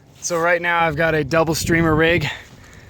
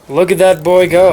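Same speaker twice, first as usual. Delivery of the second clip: announcer; 4 semitones higher, 10 dB louder than usual. Acted.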